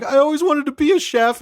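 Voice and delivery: high-pitched hammed-up tearful wail; mock crying. Retelling one guy's confession at dinner, mock crying. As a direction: imitate a man blubbering out a confession. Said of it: "one guy's crying".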